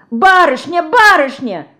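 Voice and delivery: high, loud eager calls; calling out. Acted. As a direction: call her over across the yard. Ульянка calling to Dinka. (громкий высокий зов)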